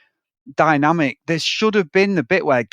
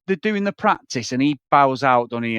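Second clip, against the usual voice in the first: ordinary delivery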